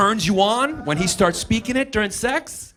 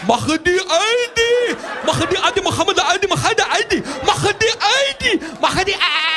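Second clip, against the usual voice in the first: shouted German-sounding gibberish; mock German; 11 semitones higher than usual. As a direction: bark like a cartoon German officer.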